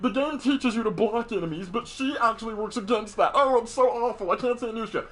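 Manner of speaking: high whiny gripe, mocking the haters; whiny complainer. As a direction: whine about it like a sore loser. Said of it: "people used to hate Nusha".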